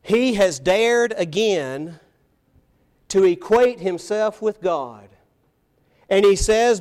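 ordinary talk, nothing marked

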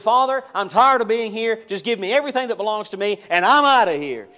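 a son demanding his share from his father; defiant prodigal son. Retold or imitated. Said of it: "who said".

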